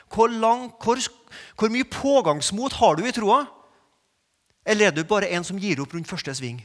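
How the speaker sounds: preacher daring the congregation, loud; challenging sermon. Acted. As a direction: press the listeners hard.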